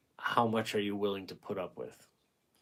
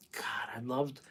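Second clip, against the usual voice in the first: whispered, dreamy "God, I loved"; fond whispered reminiscence; nostalgic whisper; 2 semitones higher, 6 dB quieter than usual. Real, not acted. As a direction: sigh it out in a whisper, lost in memory. Nostalgic memory of school days.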